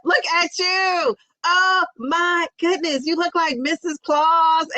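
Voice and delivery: high, slow, gushing compliment; delighted gush. Real, not acted. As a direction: gush over the festive outfit. Delighted at viewer's outfit.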